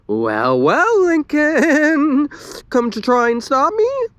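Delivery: high, slow drawn-out villain tease; taunting. A narrator's reading. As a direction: savor it like a gloating villain. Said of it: "Luann taunted".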